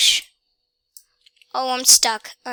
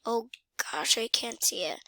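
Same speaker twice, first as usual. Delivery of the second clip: ordinary talk, nothing marked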